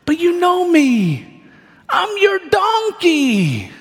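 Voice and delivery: high cartoonish donkey begging to be heard; pleading donkey voice. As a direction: plead with your master as his loyal old donkey. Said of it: "this donkey is trying to reason with him".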